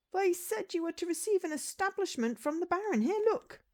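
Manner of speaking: very high-pitched villager voice; eager villager. Acted. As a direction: deliver the news high and eagerly. Villager hands over the letter.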